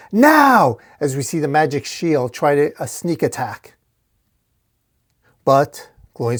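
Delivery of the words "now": high, loud drawn-out cry; scream. A narrator's reading. Dork headbutting his foe to signal an attack, screaming. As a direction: shout it long as a signal. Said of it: "and screams".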